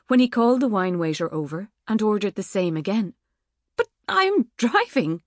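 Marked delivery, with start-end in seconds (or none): 3.8-5.2 high, slow, flustered protest; spluttering. Acted. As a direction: splutter it out in alarm. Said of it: "she spluttered".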